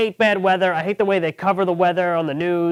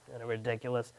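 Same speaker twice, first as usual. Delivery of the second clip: ordinary talk, nothing marked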